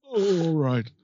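drawn-out mock British reply; hammed-up voice of a silenced sidekick; [name]. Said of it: "Shut up, Phil".

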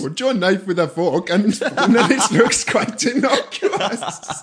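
laughing mock Welsh accent with a slow drawn-out ending; giggly put-on Welsh lilt. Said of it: "My Indian and my Welsh are pretty similar".

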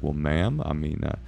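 low, calm police officer; measured, soft-spoken reassurance. Retold or imitated. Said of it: "like, very calm".

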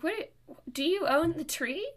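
high, loud, indignant question; retold incredulous comeback; incredulous retort. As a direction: throw it back in disbelief, pitch right up.

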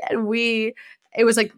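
normal talking voice

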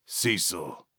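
low, quiet, restrained warning; through gritted teeth. A narrator's reading. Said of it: "Vernal grit his teeth".

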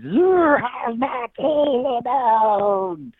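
high, slow, drawn-out ghostly wail; haunting voice. Acted. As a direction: moan it like a ghost in the woods. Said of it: "and goes".

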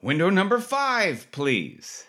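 high sing-song call of window number, dropping at please; nurse calling out. Retold or imitated.

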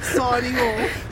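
put-on high, slow hammy British accent; a drawn-out mock British sign-off. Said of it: "Just say that in your British accent".

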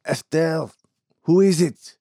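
put-on high, loud call of a sick old man; angry bark from another room. Sick old man calls out angrily from another room. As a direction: bark the name out from the next room. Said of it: "an angry bark of a cry".

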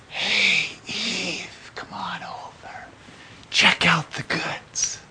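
tempting whisper, slow at the end; Satan whispering. Retold or imitated. Satan tempting Eve with a sly whisper. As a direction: lure her in with a sly whisper. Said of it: "It's all Satan's work".

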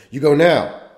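father forcing his son to confession; angry father's order. Retold or imitated. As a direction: bark the order like a furious father. Father grabbing boy by neck.

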